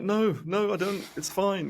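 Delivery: high, flustered mock denial; nervous backing out. Employee chickening out before his boss, flustered. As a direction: back out nervously. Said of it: "And I'm like".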